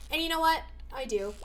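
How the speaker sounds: usual speaking voice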